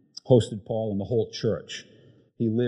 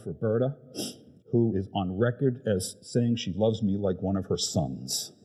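usual speaking voice

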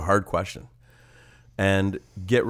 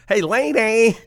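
much higher, louder goofy comic squawk; Jerry Lewis; 12 semitones higher, 6 dB louder, slower than usual. Retold or imitated. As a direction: crack it up like a zany fifties comic. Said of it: "Oh, Jerry Lewis".

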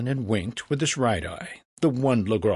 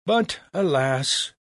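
slow, falling lament; mock regret; 6 semitones higher, 3 dB louder, slower than usual. A narrator's reading. Franklin baits Legras, falling regretful tone. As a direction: sigh it out as staged disappointment. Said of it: "continued with a falling tone".

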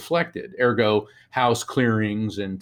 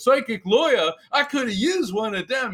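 higher-pitched wiseguy wisecrack; joking barman; 10 semitones higher than usual. Retold or imitated. Guy behind the bar joking about the lawyer, wiseguy voice. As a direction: lightly imitate a barman cracking a joke. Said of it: "the guy behind the bar goes".

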